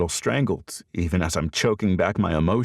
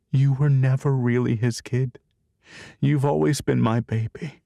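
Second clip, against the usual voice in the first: tender mother; 6 semitones higher than usual. Acted. Saren comforts crying son.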